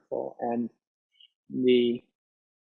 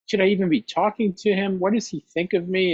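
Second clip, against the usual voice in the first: anxious worrying; 6 semitones higher, faster than usual. Acted. Anxious racing thoughts.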